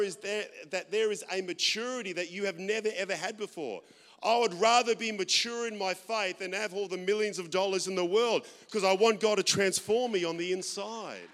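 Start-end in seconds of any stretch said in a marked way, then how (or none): 4.2-11.3 loud, passionate pulpit declaration; preaching at full force. Real, not acted. Preacher to congregation, loud and fervent. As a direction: belt it out to the congregation.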